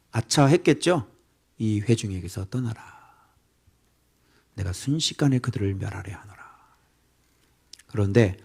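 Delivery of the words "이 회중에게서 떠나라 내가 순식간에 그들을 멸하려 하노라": hushed, soft divine warning; a preacher whispering God's words; whispered voice of God. Acted. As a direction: speak God's threat in a whisper.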